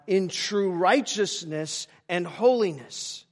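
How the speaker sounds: loud, high pulpit proclamation; full-voiced sermon climax; forceful preaching. Real, not acted. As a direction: proclaim it with slow, weighty emphasis on each phrase.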